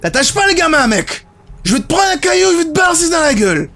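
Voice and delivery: high, shouted mock threat; squeaky mock-angry tough guy; angry man voiced. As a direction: yell it like a cartoon thug warning him off. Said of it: "il a dit comme ça".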